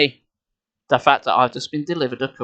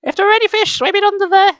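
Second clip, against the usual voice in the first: put-on much higher, louder girl's voice; Nicole's voice; 5 dB louder than usual. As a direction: squeak it out like a young girl. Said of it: "I said, in Nicole's voice".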